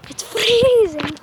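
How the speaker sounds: loud, high, slow complaint about the cold; cold exclaim. Real, not acted. Soaked in the rain outside, loudly complaining of cold. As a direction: let the cold burst out of you.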